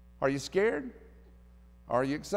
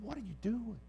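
softer, wondering aloud; hushed friend asking the timid girl; hushed question; 3 semitones higher, 10 dB quieter than usual. Retold or imitated. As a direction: ask it under your breath.

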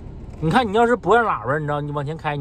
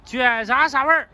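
street vendor's sales call; 7 semitones higher than usual. Real, not acted. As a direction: sing out the pitch to passers-by.

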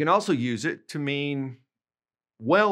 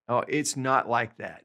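ordinary delivery

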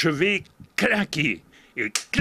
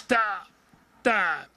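ordinary delivery, nothing marked

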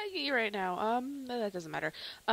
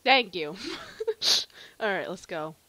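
laughing; 4 semitones lower, 8 dB louder than usual. Real, not acted. Laughing relief.

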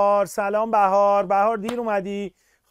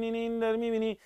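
ordinary delivery

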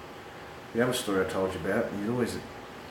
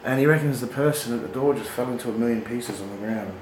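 normal talking voice

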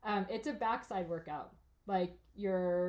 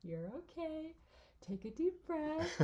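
higher, softer, slow calming voice; soothing husband; 8 semitones higher, 5 dB quieter, slower than usual. Retold or imitated. Husband calming his sore crying wife, soft and slow. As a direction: talk her down gently like a calm husband. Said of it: "And he was like".

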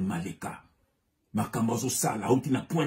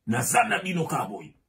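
normal talking voice

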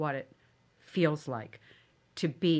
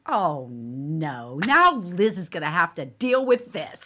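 exasperated brother; 8 dB louder, slower than usual. Retold or imitated. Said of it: "he said to my cousin".

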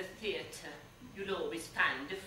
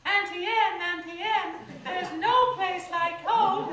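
put-on high, loud little girl voice; Dorothy impression; 12 semitones higher, 9 dB louder than usual. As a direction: cry out like a lost young girl. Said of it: "It's Dorothy, from the Wizard of Oz".

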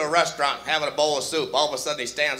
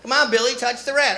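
higher, sing-song coaxing; coaxing parent; 8 semitones higher, 4 dB louder than usual. Acted. Parent urging Billy to touch grandpa's face, coaxing. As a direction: coax the child along.

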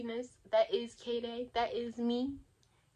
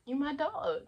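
ordinary talk, nothing marked

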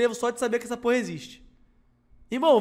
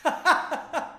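hysterical laugh; 5 semitones higher than usual. Real, not acted. Laughing at own bit.

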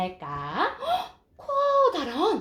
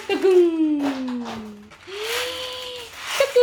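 playful peekaboo; 5 semitones higher, 6 dB louder, slower than usual. Acted. Peekaboo game with baby.

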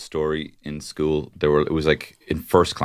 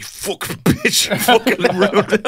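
high, laughing mock rage; playfully furious curse at the teacher; mock fury; 6 dB louder, slower than usual. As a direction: fake outrage, half laughing. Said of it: "I was angry".

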